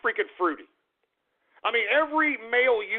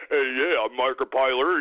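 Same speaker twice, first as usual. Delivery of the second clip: mocking impression, pitched way down; Markiplier; 9 semitones lower than usual. Ranter showing how the YouTuber talks, mocking low impression. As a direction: put on a much lower voice to ridicule him. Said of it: "he talks like that".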